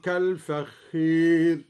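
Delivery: كالفخير with the alif leaning toward i; recited with imala. Shown as a demonstration. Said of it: "قرأ الدوري بإمالة الألف قبل الراء". (إمالة الألف في كالفخير)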